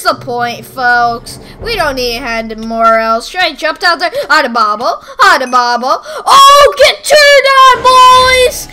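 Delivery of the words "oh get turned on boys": furious high shout; raging gamer. Real, not acted. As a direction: yell in gamer rage. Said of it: "this extreme rage".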